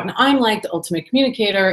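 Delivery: normal talking voice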